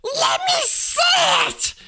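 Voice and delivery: very high, loud demand. A narrator's reading. Spoiled son demanding to see the letter, loud. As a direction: insist like a spoiled child. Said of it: "demanded Dudley".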